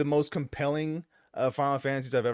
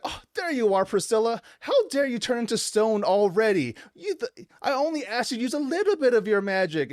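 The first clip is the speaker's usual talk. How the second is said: put-on high-pitched whiny little girl; mock a bratty child's whine; whining, put-on child voice pitched well up; 7 semitones higher than usual. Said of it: "she's, like, very whiny".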